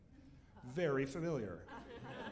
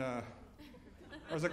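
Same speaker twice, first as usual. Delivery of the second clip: ordinary delivery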